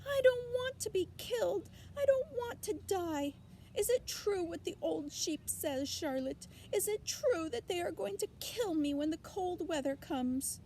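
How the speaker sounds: frightened piglet crying to the spider; crying panic. Acted. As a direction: sob out the fear of death. Pig crying in terror.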